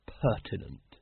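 "pertinent" said low, trailing down unsurely; "pertinent" offered low, as a vague guess; hesitant. A narrator's reading. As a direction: land tentatively on "pertinent". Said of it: "Vimes hesitated. He wasn't exactly sure".

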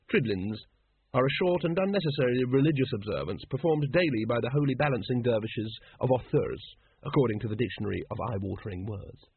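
ordinary delivery

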